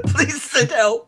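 laughing through the words. Pretend. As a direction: echo it while laughing. Laughing echo of plea.

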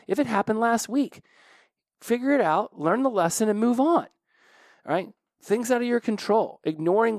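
high, loud animated ranting; excited rant. Real, not acted. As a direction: get fired up, almost shouting. Animated Back to the Future rant.

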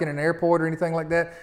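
ordinary talk, nothing marked

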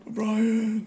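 far off, fading name; distant call. Acted. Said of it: "hear him off in the distance".

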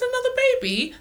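put-on high, incredulous "Another baby?"; shocked question. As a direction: sound stunned at the news. Retold shocked question to dad.

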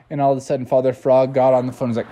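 plain reading voice

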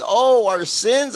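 put-on high, slow, wondering little-girl voice; imitating a toddler. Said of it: "And she says".

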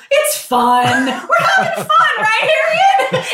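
high, strained jolly voice with a laugh; forced cheery dad. Retold or imitated. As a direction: play the dad pushing fun on his daughter. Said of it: "My dad's like".

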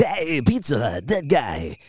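put-on commercial voice, drawn out slowly; mock ad voice-over, stretched words; Pizza Hut announcer. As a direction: imitate a comedian's pizza ad character. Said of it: "He did all of the stuff for Pizza Hut's national campaign".

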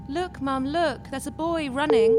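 put-on a kid tugging at mum's sleeve; excited child. Child in cabin calls mother.

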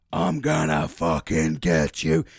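low, slow, loud demonic growl. Retold or imitated. Possessed mate threatens him in the dream, slow demonic growl. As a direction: drag it out like a possessed man.

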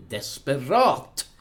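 drawn-out, desperate exclamation; melodramatic desperation. Acted. Recapping the film plot for co-hosts, melodramatically desperate. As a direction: draw the word out dramatically.

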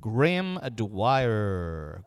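very slow stretched name; drawn-out test. Shown as a demonstration. Testing the mic, very slow drawn-out name. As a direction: stretch each syllable out. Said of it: "just talking your normal tone".

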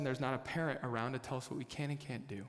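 normal talking voice

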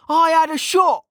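high-pitched indignant objection; protesting. A narrator's reading. Mage defending himself to the senior officer, protesting. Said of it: "Kettle protested".

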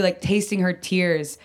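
ordinary delivery

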